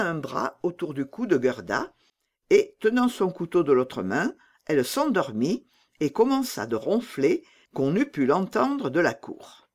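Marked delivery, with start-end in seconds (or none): none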